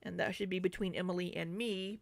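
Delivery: usual speaking voice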